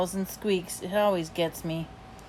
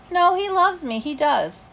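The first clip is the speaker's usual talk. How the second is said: higher-pitched defensive insistence; insistent protest; 9 semitones higher than usual. A narrator's reading. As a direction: protest it, starting high and easing down. Dog insists his owner loves him.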